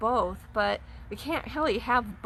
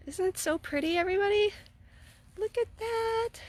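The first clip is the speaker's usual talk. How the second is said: rising high delighted gush; excited gushing; 11 semitones higher than usual. Real, not acted. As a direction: gush to everybody, much higher. Showing garden on camera.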